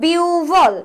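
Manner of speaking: "বিউভল" with a long biu, then a falling bhol; correct pronunciation. Shown as a demonstration. Teacher gives students the right answer, "বিউভল" drawn out as biuu-bhol. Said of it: "right answer কি হবে".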